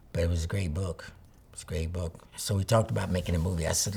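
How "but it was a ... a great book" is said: soft whisper. Real, not acted. Insisting the book was good.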